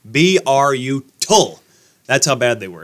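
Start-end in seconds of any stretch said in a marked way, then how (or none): 0.1-1.6 "B R U, tull" spelled out letter by letter, louder; spelled out for emphasis. Shown as a demonstration. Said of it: "This team was brutal".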